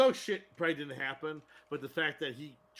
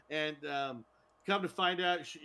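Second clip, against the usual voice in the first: normal talking voice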